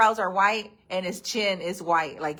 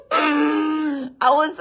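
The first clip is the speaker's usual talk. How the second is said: laughing; 7 semitones higher, 3 dB louder than usual. Real, not acted. Laughing after falling in hole.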